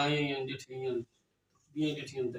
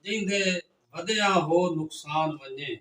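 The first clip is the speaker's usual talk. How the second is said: raised, declaimed verse with a refrain; poetry recitation; 5 dB louder than usual. Acted. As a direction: lift each line toward the shared refrain.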